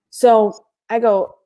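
ordinary talk

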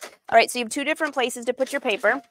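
ordinary talk

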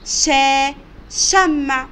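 loud شا on its own, then the word شمع; sounding out. Shown as a demonstration. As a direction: model how شمع begins with شا. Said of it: "بيبدأ بصوت شا".

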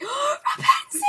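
high, loud fangirl squeal; excited fan outburst. Retold or imitated. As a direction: squeal the name on spotting your idol. Re-enacts gasping at seeing a star.

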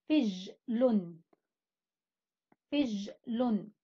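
فجل stretched very slowly twice; slow syllable demo. Shown as a demonstration. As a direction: draw out فجل for the class. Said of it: "هنا حرف الفاء يأتي مع حرف الجيم". (فجل بنطق بطيء جدًا)

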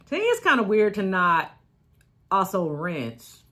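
chewing stick in mouth; speaking around brushing stick; talking while brushing teeth. Real, not acted. Seen 3: Holds a wooden chewing stick in her mouth, brushing while talking throughout.

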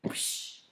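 soft whispered splash noise; hushed mock splash; whoosh sound. Shown as a demonstration. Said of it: "It kinda looks like this".

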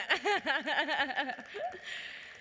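a long happy laugh rising at the end. Real, not acted. Telling the audience about the sale, a long happy laugh. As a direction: laugh along with the joy of the sale.

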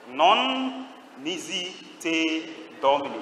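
Latin words drawn out one by one; slow intoned recitation. Shown as a demonstration. Preacher gives the saint's Latin answer, slow intoned recitation. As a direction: stretch each word out slowly for the congregation.

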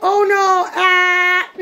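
high, loud pretend dismay for a baby; exaggerated baby-game shock; playful mock alarm. Playing with her baby.

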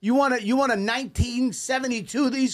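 ribbing him for acting like a grandmother; mocking. Mocking old-school coin rolling.

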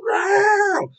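high, drawn-out "row" howl; one long high-pitched mock cheer; obnoxious fan cheer. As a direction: imitate the fan who won't stop yowling. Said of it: "the guy's still going".

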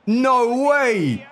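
high, loud disbelief; can't believe what just happened; shocked. Real, not acted. Opponent's shot goes through.

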